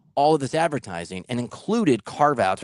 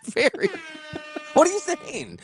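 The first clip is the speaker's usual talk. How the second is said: laughing disbelief; 13 semitones higher than usual. Real, not acted.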